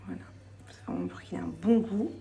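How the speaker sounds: whispering. Real, not acted. Aside while cooking, whispered.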